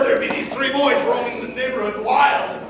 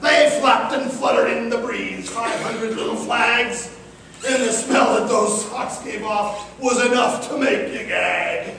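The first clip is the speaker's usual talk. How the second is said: usual speaking voice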